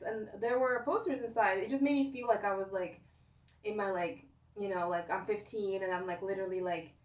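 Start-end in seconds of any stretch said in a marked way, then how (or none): none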